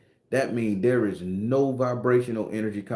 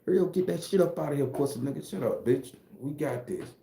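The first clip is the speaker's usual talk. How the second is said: rushed street talk; 3 semitones higher, 5 dB quieter than usual. Shown as a demonstration. Acting out a mock stickup, in rushed street talk. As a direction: bark then trail off. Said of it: "That's how we dialect here".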